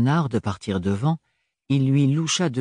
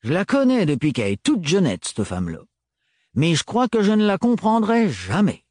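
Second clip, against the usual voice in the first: put-on higher gruff old man, fondly baffled; wistful Renard; 7 semitones higher than usual. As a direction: rise at the end in helpless wonder. Narrator voices Renard.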